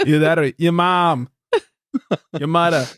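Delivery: Boston "muthah", R dropped; mock Boston accent. Host continuing the weird riff, Boston accent. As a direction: throw out a hammed-up Boston jibe.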